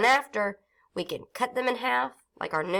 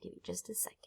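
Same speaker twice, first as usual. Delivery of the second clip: 1 whispered aside; 3 semitones higher, 20 dB quieter, much faster than usual. Real, not acted.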